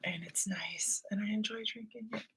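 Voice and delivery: soft breathy whisper. Real, not acted. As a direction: drop to a hushed whisper. Aside about cold water.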